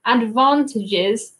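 British 'advantages' with a long 'ah'; UK accent. Shown as a demonstration. Showing how we would say that in the U K, British accent. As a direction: say advantages the southern English way. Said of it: "is how we would say that in the U K".

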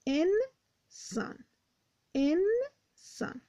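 انسان drawn out slowly, syllable by syllable; slow sounding out. Shown as a demonstration. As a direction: stretch انسان for the class. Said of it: "teacher sounds out word". (کشیده و شمرده گفتن)

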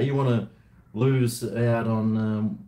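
ordinary talk, nothing marked

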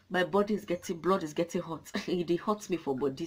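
ordinary talk, nothing marked

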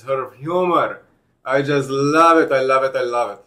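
ordinary talk, nothing marked

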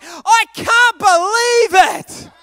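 loud, slow, high-pitched mock disbelief shout, laughing; copycat shout. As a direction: shout it back like a copycat fan. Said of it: "I don't know what I can't believe".

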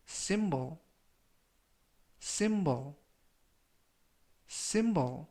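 "symbol" three times, long pause after each; slow model reading. Shown as a demonstration. Teacher reviews vocabulary for followers, slow model reading. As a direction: read out "symbol" slowly for learners to repeat. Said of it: "천천히 세 번씩 읽어드리고요".

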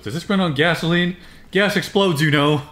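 high, loud mocking girl's question; girl's quote. Friend mocks the movie girl, loud and high. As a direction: ham up the naive girl. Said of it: "she goes".

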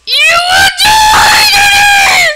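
high, slow shouted threat; drawn-out villain threat. Pretend. Gamer threatening an enemy, slow hammed-up shout. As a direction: stretch every word like a cartoon villain.

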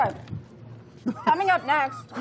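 talking through a mouthful of food; words round a bite of food; mouth full. Real, not acted. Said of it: "I'm talking with my mouth full now".

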